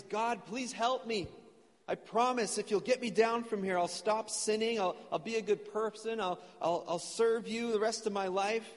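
high, acted desperate plea; crying out. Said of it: "Jack starts crying out".

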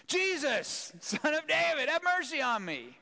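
high, loud plea for mercy; desperate cry. Acted. Blind man calling to Jesus over the disciples, loud plea. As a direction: cry out, refusing to be hushed.

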